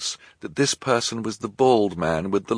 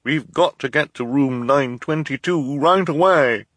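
loud, higher urgent put-on voice; disguised voice; 7 semitones higher, 4 dB louder than usual. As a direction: hurry them in disguise. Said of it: "hoping the associates wouldn't recognize his voice".

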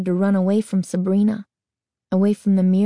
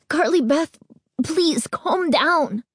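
high, slow, choked plea; choked, frightened; 8 semitones higher, slower than usual. A narrator's reading. Friend pleading with terrified girl, slow choked plea. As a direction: beg her to calm, voice catching. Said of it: "her voice came out choked and frightened".

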